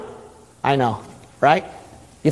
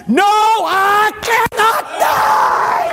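triumphant shout; 10 semitones higher, much slower than usual. Acted. Preacher voicing Jesus answering the devil inside you, triumphant shout. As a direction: thunder it out in victory.

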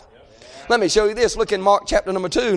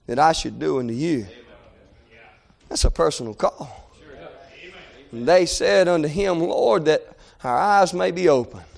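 very low, softened preacher's voice; hushed scripture reading; 7 semitones lower than usual. Acted. Preacher telling how Jesus called and healed them, hushed. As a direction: drop right down and let the verse sink in.

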